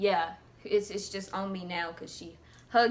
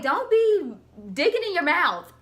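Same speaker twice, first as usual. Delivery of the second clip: higher, louder disgusted scold; mock scolding; 7 semitones higher, 5 dB louder than usual. Telling Chad to stop digging, mock scold. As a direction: scold him like a bossy kid.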